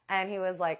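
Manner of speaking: ordinary talk, nothing marked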